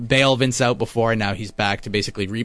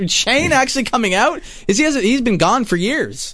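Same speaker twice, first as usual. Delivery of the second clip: higher, amazed retelling of the moment; stunned disbelief; 11 semitones higher than usual. Retold or imitated. Fan recalling his jaw dropping at the return, higher and amazed. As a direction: relive the shock of seeing him walk out. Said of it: "I was just like".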